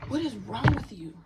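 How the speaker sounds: loud, incredulous demand; exasperated outburst. Real, not acted. Confronting someone who gave her the ick, loud exasperation. Angrily confronting an absent person.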